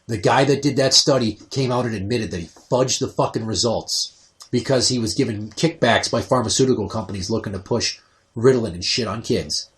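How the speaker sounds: ordinary talk, nothing marked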